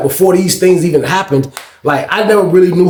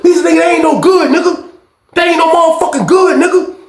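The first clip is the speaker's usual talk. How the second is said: angry insistence; 13 semitones higher than usual. Retold or imitated. Insisting angrily.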